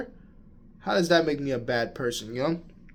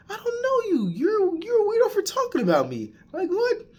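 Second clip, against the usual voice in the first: much higher, squeaky mock voice; mock dismissive voice. Brushing off a stranger who gossiped, high mock squeak. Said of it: "I wouldn't care".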